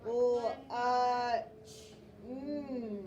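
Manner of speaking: "L-, uh, mm" held one sound at a time. Shown as a demonstration. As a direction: stretch each of "L-, uh, mm" on a level pitch. Teacher sounds out lunch.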